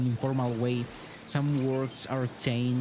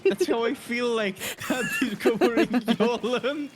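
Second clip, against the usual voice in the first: higher and louder, through real laughter; laughing; 9 semitones higher, 4 dB louder than usual. Real, not acted. Friend laughing while describing a meme, giggling through. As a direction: giggle the words out as you say them.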